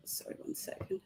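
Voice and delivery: breathy whisper, away from the mic; a quick whisper while she fixes her slides; whispered aside. Real, not acted. Speaker fixing her slides whispers an aside, whispered.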